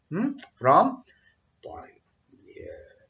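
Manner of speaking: ordinary talk, nothing marked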